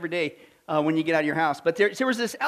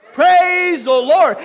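slow, loud, higher joyful shout; 6 semitones higher, 10 dB louder, much slower than usual. Retold or imitated. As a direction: shout it out with joy. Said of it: "So she shouted".

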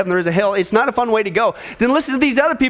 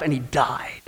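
solemn whispered ending; hushed whisper; 8 semitones lower, 7 dB quieter, slower than usual. Acted. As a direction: whisper it, almost no voice. Solemn close of each deathbed story.

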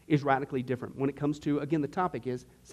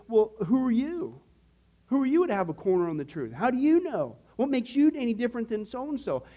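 higher-pitched, mildly indignant challenge; challenging sceptic; 5 semitones higher than usual. Acted. Sceptic confronting someone who claims the truth, challenging. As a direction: question them like a doubtful challenger.

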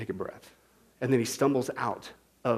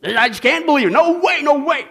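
loud, high mock protest; hammed-up shouted denial; Bob; 12 semitones higher, 11 dB louder than usual. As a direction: play a stubborn man refusing to believe. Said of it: "that was his really big hang-up".

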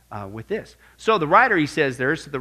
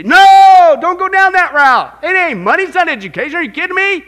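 shouted plea; 13 semitones higher, 15 dB louder than usual. Retold or imitated. Preacher voicing the Bible's warning, shouting. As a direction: yell a warning to turn back. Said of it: "And say".

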